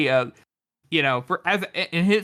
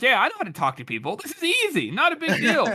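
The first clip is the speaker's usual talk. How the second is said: smug; 7 semitones higher than usual. Acted. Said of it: "He's like".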